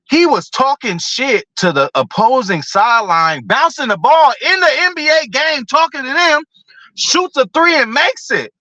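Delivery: excited hype. Real, not acted. Hyping Larry Bird's trash talk.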